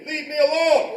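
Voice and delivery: high, forceful defensive call; loud demonstration shout. Shown as a demonstration. Teacher prompting a student against a bully, loud shout. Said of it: "why do I say this loud".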